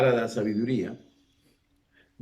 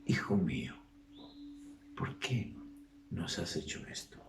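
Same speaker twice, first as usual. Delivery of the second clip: hushed, softer, half-whispered plea; tender tearful reproach; 3 semitones lower, 6 dB quieter than usual. Retold or imitated. Mary gently reproaching the boy Jesus, in a soft whisper. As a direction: scold gently, almost in a whisper. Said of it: "es posible que María haya llorado".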